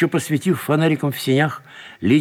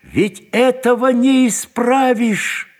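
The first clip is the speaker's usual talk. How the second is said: high, long-drawn despairing cry; booming outcry; 11 semitones higher, 4 dB louder, much slower than usual. A narrator's reading. Detained man warns it cannot be undone, booming. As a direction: ring it out slowly like a bell. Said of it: "вскричал Тверетинов голосом гулким, как колокол".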